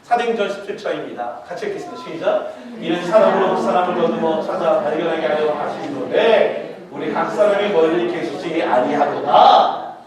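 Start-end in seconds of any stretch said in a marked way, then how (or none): 2.8-10.0 slow, loud reading aloud in unison; measured loud scripture recitation. Acted. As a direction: lead the church in reading the verse. Congregational reading.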